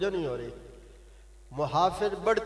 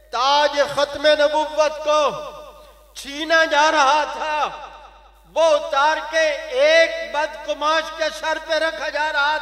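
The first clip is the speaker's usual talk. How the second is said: loud, fiery sermon, pitch raised high; preaching at full force; 7 semitones higher, 9 dB louder than usual. Acted. Preacher scolding his listeners, full-force fiery sermon. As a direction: thunder it at the congregation like a rousing preacher.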